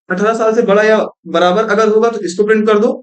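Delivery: ordinary delivery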